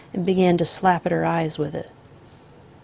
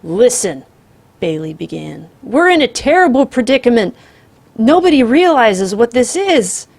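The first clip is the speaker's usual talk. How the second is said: voice cracking; 5 semitones higher, 10 dB louder than usual. Acted. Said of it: "his voice cracked".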